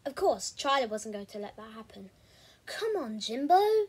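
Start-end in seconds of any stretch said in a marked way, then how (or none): 2.7-3.9 eager urging. Acted. Excited friend urging.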